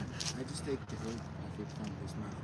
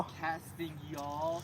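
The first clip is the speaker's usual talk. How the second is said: much higher, slow singsong Southern line; playful mock Southern accent; Southern y'all; 14 semitones higher, 3 dB louder, slower than usual. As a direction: stretch it out in a goofy Southern voice. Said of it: "He's just like".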